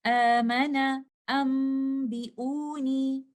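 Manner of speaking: Amana and ambiuni intoned very slowly, each mad held two counts; drawn-out recitation voice; Quranic recitation. Shown as a demonstration. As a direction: chant these words slowly in tajweed style. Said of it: "I will recite these examples like this".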